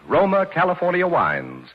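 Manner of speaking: usual speaking voice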